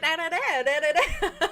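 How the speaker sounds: two-pitch chatter. Retold or imitated. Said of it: "just two different pitches".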